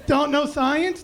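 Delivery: normal talking voice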